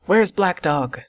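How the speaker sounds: a mild start of panic; crying out. A narrator's reading.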